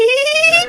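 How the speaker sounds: very high squeaky giggle; high squirrel laugh. Real, not acted. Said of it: "I'm fucking laughing".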